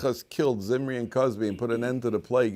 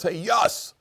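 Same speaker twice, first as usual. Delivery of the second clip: high-pitched mock yell from mid-fight; storyteller's raised, higher voice for a yelled quote; acted-out yell; 7 semitones higher, 5 dB louder than usual. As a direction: call out like a guy yelling in a brawl. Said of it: "one of the guys yells".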